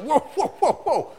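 high loud whoa, then slowing down; calling whoa. Retold or imitated. Judge halts two men quarrelling, high loud calling. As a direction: rein them in, calm down. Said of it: "the judge like".

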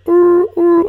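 high, loud hooting call "Ur, ur"; mock Squatch call. Comic mimicking the Squatch call the hunters hear. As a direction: hoot like a far-off beast in the woods.

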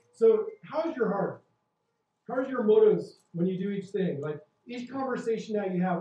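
loud, high, fervent preaching that drives each question home; preaching at full force. Acted. Pastor urging the congregation to check their hearts, preaching at full force. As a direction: preach it out to the whole room.

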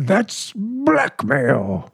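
drawn out in disbelief; slow shocked outrage. Acted. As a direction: spell out the word slowly. Shocked at cat's scheme.

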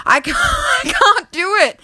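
very high, strained wail; hammed-up high-pitched meltdown; overwhelmed panic. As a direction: squeal it out, drawn out, as if overwhelmed. Mock panic at supervising excursion.

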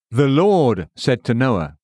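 slow, "Lord" punched and held; stress on LORD. A narrator's reading. Narrator citing scripture on Noah, stress on "Lord". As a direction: hit "Lord" hard, then ease off. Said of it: "emphasis added".

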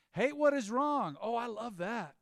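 high, loud, slow proclamation of "Hate what is wrong"; preaching. Real, not acted. Preacher proclaiming scripture to the congregation, loud and high. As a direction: preach it from the pulpit with full force.

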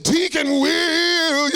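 high, loud, long-drawn half-sung call; preacher calling out. Retold or imitated. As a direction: imitate a preacher wailing for the deacon. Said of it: "He said".

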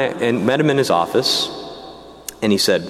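usual speaking voice

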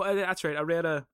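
ordinary talk

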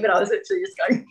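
half-laughing imitation of crying; imitated crying laugh. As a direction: act out bawling while smiling. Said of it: "I wish I could show you".

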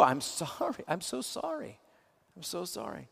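hushed, heartfelt sorry to a grieving friend; tender condolence. Shown as a demonstration. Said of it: "Just say what's true".

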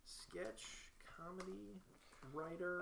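"Sketch... comedy... writer", very soft, word by word; typing aloud. Real, not acted. Typing skills into a form, soft halting self-dictation. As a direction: murmur "sketch comedy writer" with pauses between words.

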